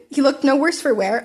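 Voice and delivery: ordinary delivery, nothing marked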